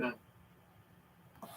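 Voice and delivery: ordinary talk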